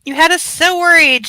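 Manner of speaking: loud, high and tearful, close to crying; loud, high, tearful relief; tearful shout. A narrator's reading. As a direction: shout it through tears. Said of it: "she shouts through her tears".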